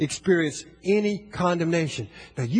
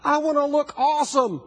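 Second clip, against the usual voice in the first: put-on toddler voice; 5 semitones higher than usual. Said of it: "She says".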